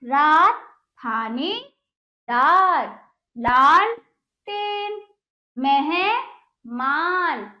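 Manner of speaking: very slow, words split into stretched parts; teacher sounding out long words piece by piece; broken-up reading. Shown as a demonstration. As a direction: hold each syllable long and pause inside the word. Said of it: "तो इस प्रकार हमने इसको पढ़ा".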